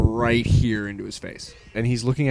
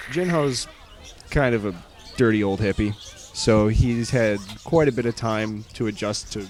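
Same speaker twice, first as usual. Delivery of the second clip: ordinary talk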